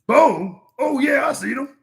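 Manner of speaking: high excited squeal. Acted. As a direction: burst out eager and high. Bug excitedly recognizes name.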